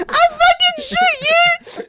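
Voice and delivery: shrieked, very high, slow mock shout; hammed-up kid threat. Boy aiming a Nerf gun at a man, hammed-up high shriek. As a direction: squeal it like an overwrought little boy.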